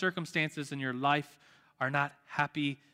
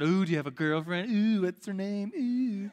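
singsong ooh, pitched up as it goes; teasing; 3 semitones higher than usual. Acted. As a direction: tease a friend about a crush. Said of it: "I started to tease him".

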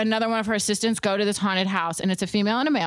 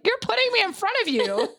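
exasperated woman; 13 semitones higher than usual. Retold or imitated. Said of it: "she's like".

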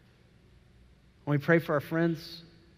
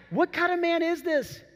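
much higher, astonished question; amazed; 10 semitones higher than usual. Retold or imitated. Disciples amazed at the calmed storm, higher astonished question. Men amazed at calm sea.

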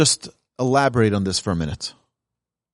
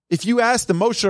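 usual speaking voice

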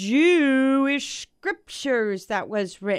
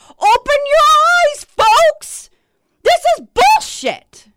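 much higher and louder, yelling in outrage; furious shout; 12 dB louder than usual. Real, not acted. Vlogger raging at believers, a furious high shout. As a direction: scream it at the viewers to wake them. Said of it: "Oh, I'm fired up about it".

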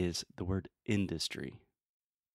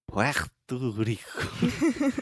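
drawn-out, hammed-up fake Spanish accent, higher; Trump's mock Spanish; 4 semitones higher, much slower than usual. Teacher mocks Trump, overdone fake Spanish accent. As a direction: stretch Puerto Rico into exaggerated fake Spanish, PWEHHR-to. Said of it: "Trump, for some reason, thinks you say".

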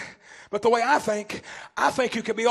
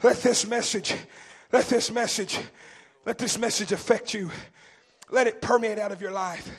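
soft preaching appeal; 2 semitones lower, 3 dB quieter than usual. Acted. Preacher pleading gently with the congregation, softly.

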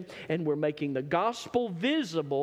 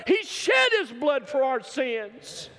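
rising pitch and volume into a shouted climax; preaching at full force; 6 semitones higher, 4 dB louder than usual. Acted. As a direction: build the sermon up to a roar.

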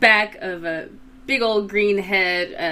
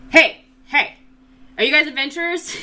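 calling out; 7 semitones higher, 4 dB louder than usual. Acted. Bartender calls out to party.

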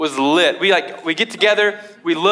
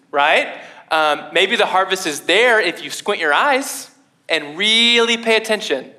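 normal talking voice

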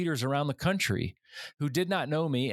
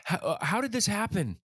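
imitated amazed classmates, pitched higher; 6 semitones higher than usual. Classmates questioning the student back from fishing, amazed. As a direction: voice their astonishment in a raised pitch. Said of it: "my classmates were amazed".

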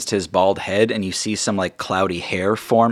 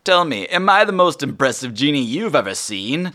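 genie voice; 6 semitones higher, 3 dB louder than usual. Acted. Genie boasts.

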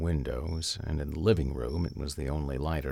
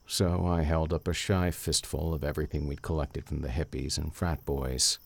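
usual speaking voice

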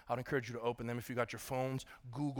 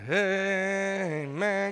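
loud, higher, held-out amen; raised, ringing preacher's amen; drawn-out amen; 5 semitones higher, 5 dB louder than usual. Real, not acted. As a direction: call out a long congregational amen. Calls congregation to answer amen.

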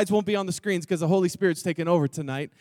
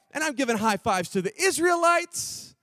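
usual speaking voice

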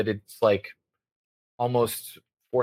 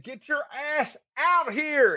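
put-on drunk yelling; 14 semitones higher, slower than usual. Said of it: "I said".

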